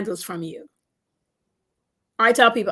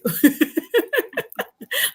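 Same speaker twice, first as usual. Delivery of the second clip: bursts into high-pitched laughter; 13 semitones higher than usual. Real, not acted. Big laugh after story.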